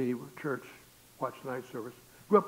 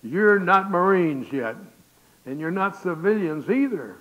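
slow, loud, raised-voice command; drill instructor shout; 4 semitones higher, 13 dB louder, slower than usual. Retold or imitated. Drill instructor addressing new recruits at boot camp, bellowing. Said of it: "First thing the D I said".

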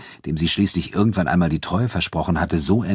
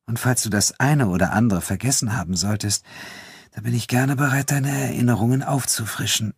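higher-pitched, teasing female character; a hint of flirtatious teasing; lightly voiced Elena, teasing; 6 semitones higher than usual. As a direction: offer it coyly, pitched up.

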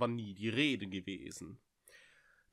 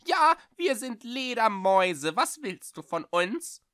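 much higher, slow, thin creature voice; a hostile little voice from the dark; thin, sharp bat voice, hostile; 11 semitones higher, 7 dB louder than usual. Acted. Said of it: "eine dünne Stimme scharf und feindselig".

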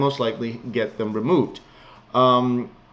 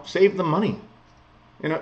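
normal talking voice

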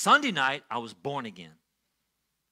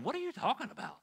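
high, softer, bewildered question; baffled inner thought; 9 semitones higher, 5 dB quieter, faster than usual. Retold or imitated. Man startled by his own words, baffled inner thought. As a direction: question yourself in a high, hushed squeal of disbelief. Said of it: "And I'm thinking".

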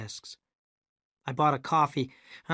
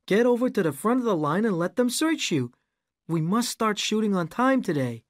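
higher, louder impatient order; impatient director; 7 semitones higher, 4 dB louder than usual. A narrator's reading. Rick ordering the narrator to be searched. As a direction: hurry him along.

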